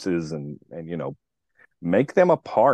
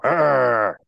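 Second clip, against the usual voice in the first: higher mock-scary "arr"; playful imitation of a scary roar; imitated growl; 6 semitones higher, 4 dB louder than usual. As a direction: growl like a little monster. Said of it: "and sometimes I'll go".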